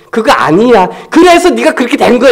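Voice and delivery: lecturing. Retold or imitated. Pastor imitating someone lecturing a friend, mock scolding. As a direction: set them straight, scolding. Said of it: "꼭 해주고 싶은 말이 있잖아요".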